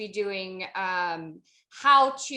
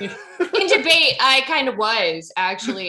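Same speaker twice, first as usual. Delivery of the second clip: loud, laughing confession; real laugh breaking into louder speech; laughing admission; 11 dB louder than usual. Real, not acted. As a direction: own up to it through laughter. Laughing at being called a jerk.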